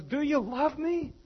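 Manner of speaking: high, slow, gentle question; tender questioning Jesus. Retold or imitated. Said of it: "he goes".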